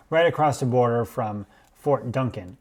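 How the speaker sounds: ordinary talk, nothing marked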